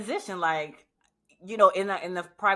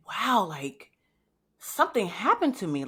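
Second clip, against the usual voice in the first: ordinary talk, nothing marked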